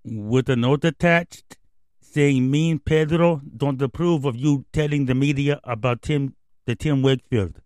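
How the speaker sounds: lightly voiced Dominican slugger, broken English; a hint of David Ortiz's Spanish-accented English. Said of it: "Thank you, David Ortiz, our special guest".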